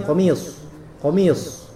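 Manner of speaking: "komis" with lips pushed out on the final s, like "komius"; wrong rounded sod. Shown as a demonstration. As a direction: pucker into the end of "komis". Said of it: "Enggak, enggak seperti itu". ("komis" salah, bibir monyong sampai berbunyi "komius")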